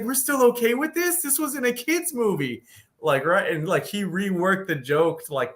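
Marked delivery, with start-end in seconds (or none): 0.0-2.6 high-pitched incredulous questioning; squeaky-high mock outrage; mock disbelief. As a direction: imitate a comic protesting in disbelief. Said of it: "and he's like".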